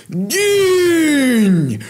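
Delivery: very high, drawn-out death scream; attacking roar. Acted. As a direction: roar it as you lunge. Villain roars while attacking.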